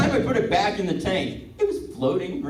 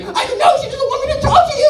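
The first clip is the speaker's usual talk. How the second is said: high, loud, fast and flustered; apologetic dog; 8 dB louder than usual. Pretend. Leashed dog excusing itself to its friend, high and fast. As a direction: rush out an embarrassed excuse. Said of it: "the other dog's just like".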